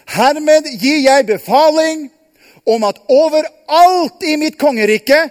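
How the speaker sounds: loud, measured decree reading; royal proclamation. Acted. The king proclaims his decree to all the realm, loud and solemn. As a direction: announce it to the whole kingdom.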